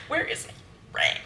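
slow, voiceless mock demand; hushed, playful stage whisper; joking whisper. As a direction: whisper it slowly as a teasing hint. Jokey demand, then "just kidding.

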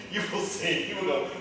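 ordinary talk

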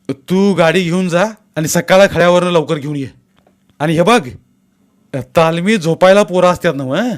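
a village elder commanding in a crisis; elder taking charge. Acted.

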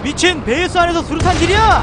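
high, loud outburst at the screen; shocked shout. Real, not acted. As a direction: yell it in disbelief. Enemy throws grenade inside base. (놀라서 크게 외치기)

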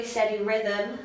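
ordinary talk

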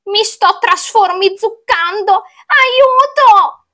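high wailing witch in distress; moaning choked cry. A narrator's reading. Witch turning into a pumpkin, wailing climbing to a cry. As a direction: moan it high, rising to a cry for help. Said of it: "gemette la strega con un grido soffocato".

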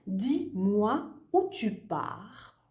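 slow, careful example sentence read to a student. Shown as a demonstration. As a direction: read the example slowly, word by word. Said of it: "Par exemple". (phrase d'exemple lue lentement)

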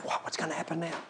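very soft awed question; hushed amazement. Retold or imitated. Crowd whispering what happens next, awed. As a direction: breathe out your amazement. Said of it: "Everyone's saying".

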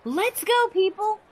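high, eager call to the crowd; excited call. Acted. Cheerful call to everyone.

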